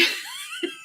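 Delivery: a high squeaky laugh bursts out; high squeal laugh. Real, not acted. Laughing at herself about quitting the podcast, high squeal. Laugh after self-quote.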